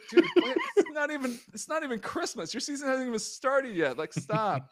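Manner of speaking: incredulous. Retold or imitated. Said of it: "We're just like".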